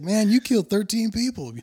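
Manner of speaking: high, loud mock disbelief; incredulous outburst. Friend reminds forgetful Ari of his past, incredulous. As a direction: blurt it out as the astonished friend. Acted friend blurts the truth.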